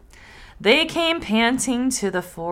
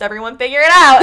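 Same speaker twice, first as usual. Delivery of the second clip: 1 mock smug; 3 semitones higher, 11 dB louder, faster than usual. Mocking smug podcaster.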